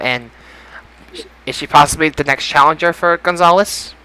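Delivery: ordinary talk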